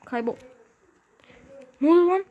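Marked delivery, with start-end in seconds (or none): 1.8-2.2 sudden high loud yelp of surprise; startled shout. Real, not acted. Gamer caught off guard, startled shout. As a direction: jump out of your seat and shout.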